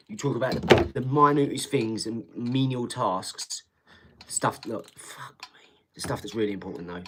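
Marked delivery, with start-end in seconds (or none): 5.0-5.7 whispered. Real, not acted. Exasperated aside mid-rant.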